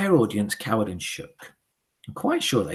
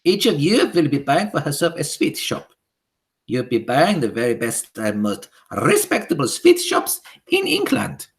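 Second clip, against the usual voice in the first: high, loud witch voice with a foreign lilt; high imperious witch decree; Grand High Witch; 6 semitones higher, 7 dB louder than usual. Acted. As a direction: command the witches like a tyrant queen.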